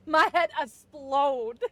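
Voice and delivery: loud, slow mind-blown shout; amazed. Pretend. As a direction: yell it like your mind's blown. Reacting to magic trick.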